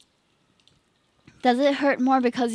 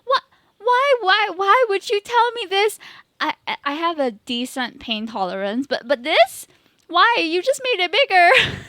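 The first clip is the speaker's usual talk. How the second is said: high, loud, whiny mock cry; mock outraged wail; 9 semitones higher, 6 dB louder than usual. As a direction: wail it like a betrayed child. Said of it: "And I'm like".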